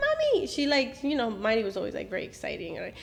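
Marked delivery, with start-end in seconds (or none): 0.1-0.5 put-on high child's call of "Mommy"; little daughter calling out in the background, high and bright; little girl calling. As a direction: pitch way up like an excited small girl. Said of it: "She said".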